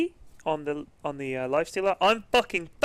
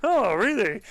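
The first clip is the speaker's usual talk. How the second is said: slightly raised, awkward feigned interest; grimacing politeness; 4 semitones higher, 4 dB louder, slower than usual. Retold or imitated. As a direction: cover a cringe with polite surprise. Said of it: "I was, like, grimacing".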